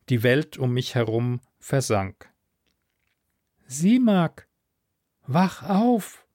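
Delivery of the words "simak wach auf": high-pitched, urgent wake-up call; urging. A narrator's reading. Voice in the head rousing a fainting diver, urging. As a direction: urge them back to consciousness. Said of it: "Die Stimme in meinem Kopf drängte".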